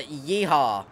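drawn-out, lazy cowboy holler; hammed-up cowboy whoop; slow cowboy yeehaw. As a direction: stretch the yeehaw like a movie cowboy. Cowboy persona on tractor.

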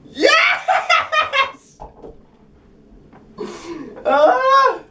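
very high, loud laughing yell of victory; real elated whoop and laugh; triumphant shout. Real, not acted. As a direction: cheer with real laughing joy. Trick succeeds at last.

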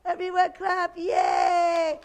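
high, loud, stretched celebration; triumphant cheer. Pretend. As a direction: cheer it out for the kids. Celebrates trick with children.

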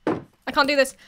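ordinary talk

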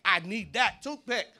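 mock desperate, high and loud; hammed-up panic over a toothpick. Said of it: "you ain't like".

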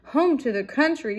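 fleeing storybook mouse; squeaking. A narrator's reading. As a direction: squeak it back over the shoulder. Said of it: "squeaked Annie".

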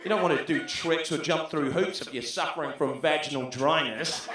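ordinary delivery

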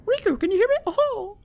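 very high cartoon mouse falsetto; Mickey Mouse. Mouse king calling out to lost friend, squeaky falsetto impression. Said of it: "Mickey Mouse".

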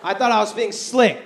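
usual speaking voice

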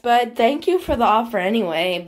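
usual speaking voice

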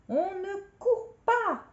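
high, loud, drawn-out shout; exclaimed rebuke. Acted. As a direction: cry out a stretched-out warning at a runner. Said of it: "s'écria mademoiselle Botan".

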